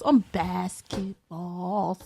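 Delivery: slow, low, drawn-out words; slow, deliberate clarification; spelling it out. Real, not acted. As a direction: stretch it out low and soft. Spelling out the obvious.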